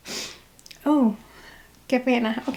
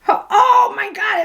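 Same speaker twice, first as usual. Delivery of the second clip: high, loud shocked cry; grossed-out shout; 8 semitones higher, 4 dB louder than usual. Real, not acted. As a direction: cry out in disgust. Reacting to gross earwax.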